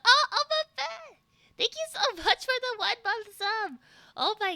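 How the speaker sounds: high, loud, laughing thanks; excited squeal. Real, not acted. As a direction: gush over the new sub.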